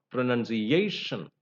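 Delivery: "pronunciation" stressed on -A-; penultimate stress. Shown as a demonstration. Teacher illustrating the rule, stress on last but one syllable. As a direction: stretch the A before the final syllable of "pronunciation". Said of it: "stressed on the last but one syllable".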